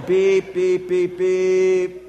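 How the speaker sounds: loud, high laughing beep beep; sing-song beeping. Retold or imitated. Energized by honking in traffic, gleeful loud beeps. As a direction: chant the honks gleefully. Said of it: "I really started beeping".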